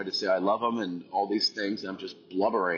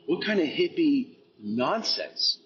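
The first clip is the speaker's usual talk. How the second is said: higher-pitched, disbelieving questions; mock incredulous; 7 semitones higher than usual. Recalling doubts about Eric's hippie talk, mock incredulous. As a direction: replay your baffled inner skeptic. Voices his earlier skeptical thoughts.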